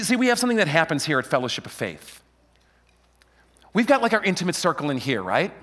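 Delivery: ordinary talk, nothing marked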